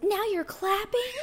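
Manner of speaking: very high, slow incredulous question; mock outraged disbelief. Karen turns round to the clapping table, high mock disbelief. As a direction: imitate an affronted customer, pitch shooting up. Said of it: "she turned around and was like".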